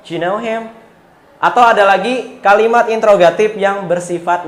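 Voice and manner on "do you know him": "Do you" run together as one quick "dyu", the question ending high; reduced do you. Shown as a demonstration. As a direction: blend "Do you" into "dyu" casually. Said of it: "disingkat aja do". ("Do you" dilebur jadi "dyu" yang cepat, nada naik di akhir)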